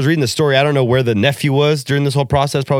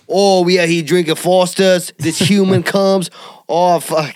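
higher, hammed-up bad Australian; failed Aussie accent; 6 semitones higher than usual. Kangaroo grumbling over Foster's about humans, bad Aussie accent. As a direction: attempt a broad Australian and botch it. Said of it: "can't do it, Aussie".

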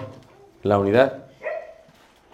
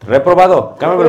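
mock stern verdict; 4 semitones higher, 6 dB louder than usual. Teacher failing student jokingly.

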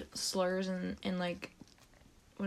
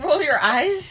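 surprised; 6 semitones higher, 7 dB louder, slower than usual. Retold or imitated. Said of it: "I was like".

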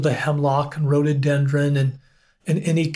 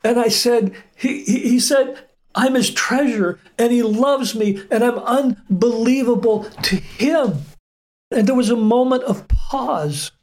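much higher, choked with real emotion; voice breaking; 8 semitones higher than usual. Real, not acted. A man retelling how God called him treasure, voice breaking. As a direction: push the words through rising tears.

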